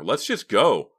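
high, loud urging; fearful. A narrator's reading. Mickey begs Clay to leave the store, frightened. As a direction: urge a friend to leave, alarmed. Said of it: "suddenly wide with fear".

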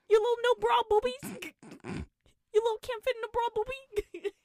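very high, silly teasing voice; mocking taunt. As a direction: tease in an exaggerated squeak. Mocking a character's childish taunt.

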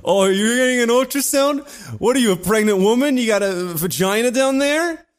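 high, loud sneering voice of the teacher; mock him in a high, loud jeering voice; mocking substitute teacher. Mocking a student who needs an ultrasound, high and loud. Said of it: "And he went".

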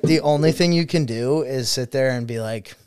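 usual speaking voice

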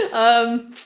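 usual speaking voice

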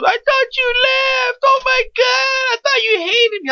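very high, wailing imitation of her; hammed-up sobby wail, words stretched out; mock crying. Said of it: "She's standing there crying".

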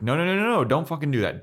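loud, high rush of refusals; urgent protest. Retold or imitated. Spike stops Buffy Bot telling Glory, loud and urgent.

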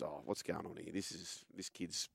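quietly muttering to himself; a hushed, puzzled aside to himself. Retold or imitated. Coach puzzled, talking to himself.

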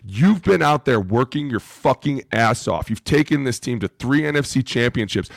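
ordinary talk

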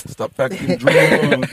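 slow, unvoiced stern "Stop acting drunk."; mimicking stern friend. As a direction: imitate a friend scolding a drunk, slowly. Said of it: "he's like".

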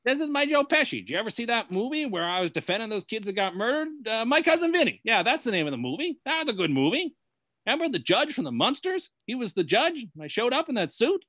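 a hint of a fast-talking tough guy; Joe Pesci impression. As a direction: play a half-serious movie wise guy. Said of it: "That's your Joe Pesci impression?".

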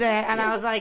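ordinary talk

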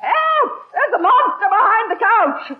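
high shriek of fright; frightened yelp then rattled; startled cry. Acted. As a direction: shriek at the monster behind the couch. Startled by moose head.

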